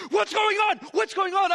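high, urgent mock panic; excited high-pitched retelling of alarm; frantic wife. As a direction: imitate a wife bursting in, loud and high.

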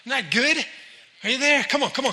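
ordinary talk

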